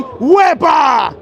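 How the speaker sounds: party-cheer "wepa" yell; shouted wepa. Shown as a demonstration. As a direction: belt "wepa" out to show how. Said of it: "On the count of three".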